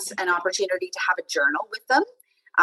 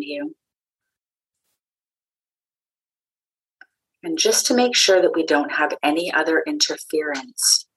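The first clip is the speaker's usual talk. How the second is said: calm meditation guide with long pauses; unhurried, grounding voice guiding listeners inward; guided meditation. Acted. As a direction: lead a meditation, leaving space between phrases.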